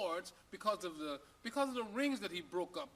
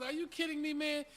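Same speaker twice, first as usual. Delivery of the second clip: high, disbelieving; can't believe the question; high incredulous owner; 7 semitones higher than usual. Acted.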